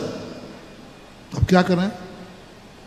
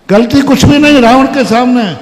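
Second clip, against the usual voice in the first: preaching at full force; 4 semitones higher, 5 dB louder than usual. Acted. Preacher declaiming.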